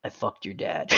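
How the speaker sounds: very soft, low murmur; quiet deadpan parting shot; Brian. Acted. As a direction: murmur it low, barely voiced. Brian's parting line to Quagmire.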